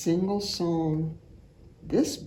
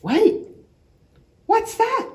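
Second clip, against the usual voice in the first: surprised; 13 semitones higher, 6 dB louder than usual. Acted. Story moment of surprise.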